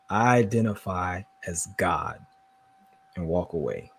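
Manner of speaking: low, soft deadpan; deadpan quiet. Shown as a demonstration. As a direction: say it flat and dry. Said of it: "anytime somebody asks your pronouns, you say".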